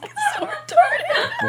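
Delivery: crying with laughter; squeaky high laugh through words. Real, not acted. Laughing hard at the joke.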